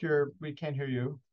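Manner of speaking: normal talking voice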